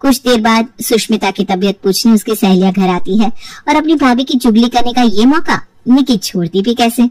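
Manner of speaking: usual speaking voice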